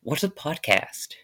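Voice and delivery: lightly mimicked puzzled dad; mock a dad's innocent question, lightly; imitating dad. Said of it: "and he's like".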